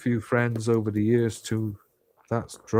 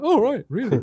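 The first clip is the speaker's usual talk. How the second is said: mocking neighbour; 12 semitones higher, 4 dB louder than usual. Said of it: "He's like".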